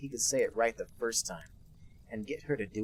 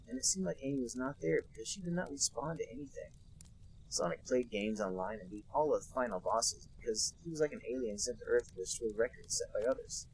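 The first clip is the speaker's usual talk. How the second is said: ordinary talk, nothing marked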